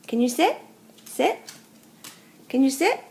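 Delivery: loud, high sit command; firm command to the dog. Real, not acted.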